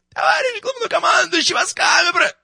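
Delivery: пронзительный крик намного выше и громче; отрапортуй фальцетом во всё горло; заорал фальцетом. Acted. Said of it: "он заорал фальцетом". (рапорт криком фальцетом)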